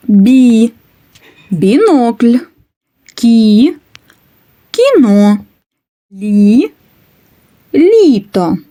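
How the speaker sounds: бі then бінокль, кі then кіно, each slowly and clearly; example syllables. Shown as a demonstration. Teacher modelling a syllable word game. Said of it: "Наприклад".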